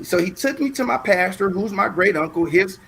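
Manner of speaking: normal talking voice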